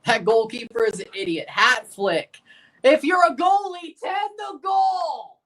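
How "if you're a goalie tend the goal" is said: angry shout. Real, not acted. Fan repeating his rant at the goalie, angry shout. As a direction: throw the line at the screen once more.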